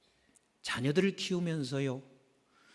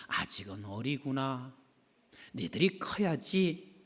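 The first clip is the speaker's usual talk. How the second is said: indulgent parent; 4 semitones lower, 6 dB quieter, slower than usual. Retold or imitated. Patient parent watching kids.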